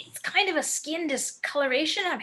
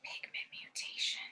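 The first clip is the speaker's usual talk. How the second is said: terrified whisper; 14 dB quieter than usual. Acted. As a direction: breathe the words in horror. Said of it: "shocked and terrified".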